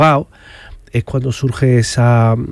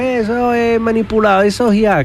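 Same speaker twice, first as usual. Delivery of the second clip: raised, high-pitched imitation of doubters; imitated sceptics' objection; 10 semitones higher than usual. Investigator voicing sceptics dismissing footage, high imitated objection.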